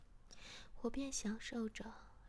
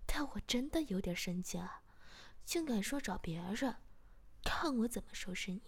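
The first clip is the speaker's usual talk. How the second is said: ordinary delivery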